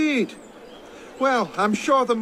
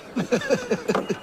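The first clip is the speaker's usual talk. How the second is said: a short quiet snicker at his expense; brief teasing chuckle; mocking laugh; 4 dB quieter than usual. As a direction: laugh softly, amused at his snub. Servant mocking Arnaud's exclusion.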